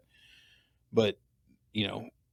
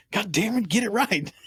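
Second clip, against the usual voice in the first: high mock outburst, scolding a name flub; hammed-up Irish friend snapping get it right; high, hammed-up mock complaint; mock exasperated Irishman; 11 semitones higher than usual. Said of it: "he just like".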